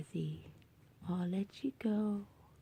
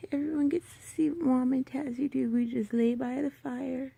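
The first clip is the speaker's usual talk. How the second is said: usual speaking voice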